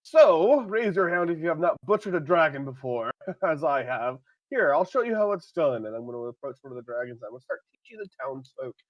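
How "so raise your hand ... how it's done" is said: very loud, high showman's shout; shouted call. Acted. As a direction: bellow to the whole crowd. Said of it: "I'm gonna shout, call out".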